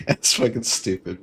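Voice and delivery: ordinary delivery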